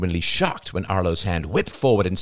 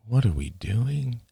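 slow, higher, hollow question; empty; 6 semitones higher, slower than usual. A narrator's reading. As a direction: ask it drained, with the fight gone. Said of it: "asked Rachel emptily".

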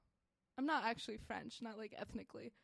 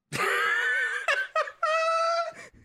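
high, shrieking laughter, very loud; loud burst of laughter; 13 dB louder than usual. Real, not acted. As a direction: explode into a long high cackle. Bursts out after mom joke.

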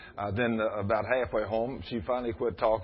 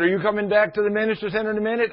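eager call; 10 semitones higher, 6 dB louder, faster than usual. Retold or imitated. Wife calling to husband outside.

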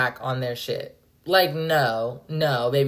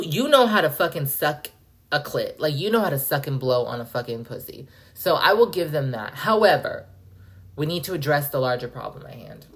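ordinary delivery

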